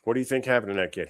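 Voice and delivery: ordinary talk, nothing marked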